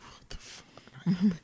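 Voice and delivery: hushed, drawn-out whisper; whispered disbelief. Real, not acted. Co-host reacting to early Sunday scaries, whispered disbelief. Aside reacting to co-host's dread.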